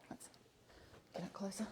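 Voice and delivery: whispered aside to herself; murmured while she moves in close. Real, not acted. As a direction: breathe it under your breath. Aside while moving the camera.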